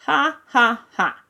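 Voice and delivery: tired mock laugh. A narrator's reading. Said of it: "He sounded as tired as D'Artagnan".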